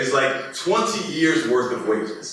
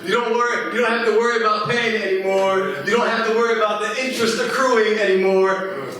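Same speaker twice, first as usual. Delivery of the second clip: high, laughing, giddy freedom; imagining student loans forgiven, laughing; laughing relief; 6 semitones higher, faster than usual. Pretend. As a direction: laugh with relief at no more payments.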